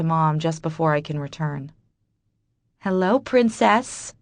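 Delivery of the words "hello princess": slow, higher, loving hello; tender greeting. Acted. Mother greeting her baby daughter after work, tender and slow. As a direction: greet your baby with all your love. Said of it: "I smile".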